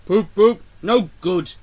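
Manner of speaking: loud poop poop then a grumpy no good; big engine hooting loudly, then grumbling. Acted. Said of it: "grumbled Gordon".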